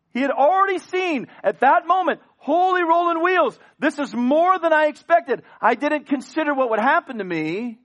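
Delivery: high, loud panicked exclamation; Cain's alarm. Acted. Preacher voicing Cain's shocked panic, high and loud. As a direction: blurt out the shock of it.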